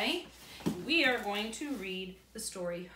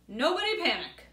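loud, higher call from the kitchen; 6 semitones higher, 8 dB louder than usual. Acted. Dad calling from the kitchen during blackout, loud. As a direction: call across the dark house. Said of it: "their dad called from the kitchen".

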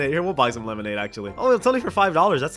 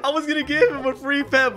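laughing disbelief; 5 semitones higher than usual. Real, not acted. Streamer baffled by an angry player, laughing in disbelief.

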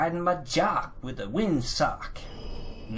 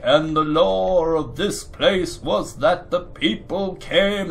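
slow, louder storyteller NPC drone; droning NPC; 2 semitones higher, 5 dB louder, slower than usual. Retold or imitated. Game NPC reciting lore to the player, slow droning voice. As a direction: drag out the lore like an endless quest giver. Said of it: "They're like".

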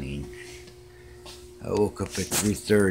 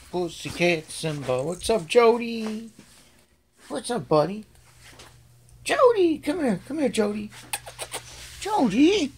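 much higher, calling the cats; high pet voice; 12 semitones higher than usual. Real, not acted. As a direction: coo at the cat to come.

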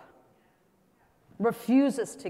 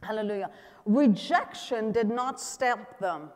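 usual speaking voice

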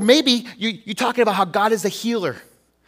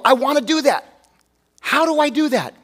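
higher, louder eager voice; eager imitated question; 7 semitones higher, 5 dB louder than usual. Pastor voicing an eager believer, higher and louder. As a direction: play the keen believer asking how.

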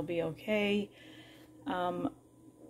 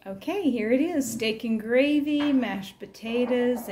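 higher, louder, proud showing off the plate; excited reveal; 5 semitones higher, 6 dB louder than usual. Real, not acted. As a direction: announce the dinner like a prize. Proudly showing the finished dinner.

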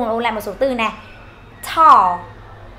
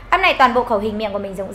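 ordinary talk

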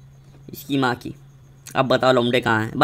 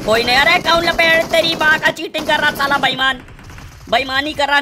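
outraged accusation; 7 semitones higher than usual. Real, not acted. Gamer accusing an opponent of cheating, outraged. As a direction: cry foul in a raised voice.

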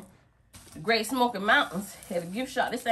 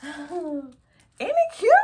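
excited; 10 semitones higher, 5 dB louder, much slower than usual. Real, not acted. Gasps showing off new purse.

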